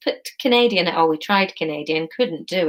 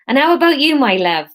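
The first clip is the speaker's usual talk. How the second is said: put-on Somerset accent; 5 semitones higher, 6 dB louder than usual. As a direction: sing it out in a broad Somerset accent. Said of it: "this is gonna be Somerset".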